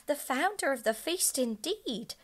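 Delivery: Missus Cratchit angry. A narrator's reading. The wife scolding about Scrooge, loud and indignant. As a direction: spit out her scorn for Scrooge. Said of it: "cried Missus Cratchit, reddening".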